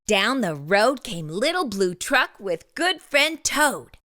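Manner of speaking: ordinary delivery, nothing marked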